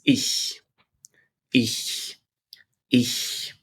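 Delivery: ich as a long unvoiced hiss like a cat. Shown as a demonstration. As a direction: hiss the ch in ich through the teeth. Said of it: "the hissing cat pronunciation".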